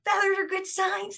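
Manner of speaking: light up with delight at the sign. Retold or imitated. Joy at finding feathers.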